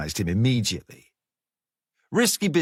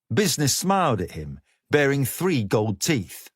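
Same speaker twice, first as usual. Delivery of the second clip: plain reading voice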